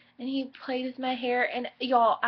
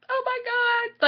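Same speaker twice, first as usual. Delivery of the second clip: overwhelmed "oh my God"; 12 semitones higher, slower than usual. Retold or imitated. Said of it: "Like, I was just bawling".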